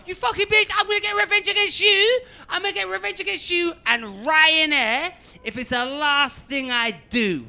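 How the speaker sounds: loud, high theatrical fury, slowing at the end; vengeful vow. Retold or imitated. Passenger to laughing clerk while dragged away, theatrical fury.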